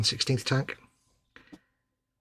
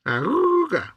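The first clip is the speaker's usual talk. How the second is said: high hooting horn honk; clown honks and squeaks; 12 semitones higher, slower than usual. Pretend. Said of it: "I see what you did there".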